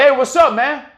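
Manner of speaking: sarcastic hey what's up, man; mock friendly taunt. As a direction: call out to him all buddy-buddy before striking. Taunting the guy before kicking.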